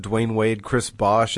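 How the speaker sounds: ordinary talk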